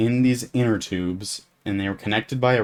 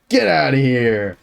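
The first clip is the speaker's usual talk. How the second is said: loud, raised-pitch yell; mock menacing shout; 4 semitones higher, 7 dB louder than usual. Tall camper after grabbing the kid's tag, mock-fierce yell. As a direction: bellow it to send the kid away. Dismissing the defeated kid.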